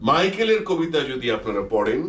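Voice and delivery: ordinary talk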